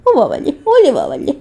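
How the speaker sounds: ordinary delivery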